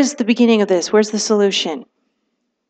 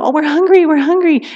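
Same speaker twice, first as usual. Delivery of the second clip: higher mock kid whine; whiny kids; 6 semitones higher than usual. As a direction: whine like a hungry child. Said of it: "the kids go crazy".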